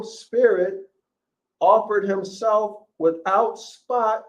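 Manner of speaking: slow dramatic Bible reading; scripture reading. Acted. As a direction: proclaim each phrase like a preacher at the pulpit. Reads Hebrews aloud, slow, raised.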